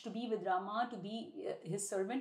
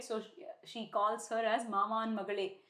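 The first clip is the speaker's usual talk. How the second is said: ordinary talk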